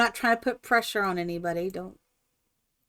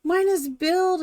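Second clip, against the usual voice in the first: higher, proud announcement; excited announcing; 9 semitones higher than usual. Retold or imitated. Mother telling her daughter the news, higher and proud. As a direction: share the big news proudly. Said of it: "I said".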